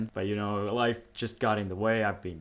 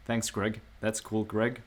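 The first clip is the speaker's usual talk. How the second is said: mildly passive-aggressive, softer thanks; 6 dB quieter, slower than usual. Real, not acted. Vlogger thanks a viewer for a suggestion, passive-aggressive. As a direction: thank him with a faint edge, softly. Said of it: "without sounding passive-aggressive".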